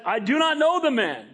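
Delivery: high-pitched, emphatic denial; sworn denial. Acted. Peter denies Jesus to the servant girls, emphatic oath. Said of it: "denied with an oath".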